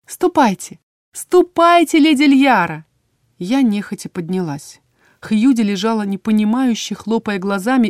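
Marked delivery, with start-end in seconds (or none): 0.1-2.8 high, lilting, louder farewell; singsong shooing. A narrator's reading. Healer shoos Льяра out of the ward, trilling singsong. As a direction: trill them out the door.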